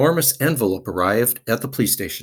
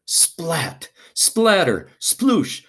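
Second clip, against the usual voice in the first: rising higher with each splat, splatter, sploosh; splashing sound words; 6 semitones higher, 4 dB louder, much slower than usual. A narrator's reading. Narrator reading the banana pudding slide to children, rising splashes.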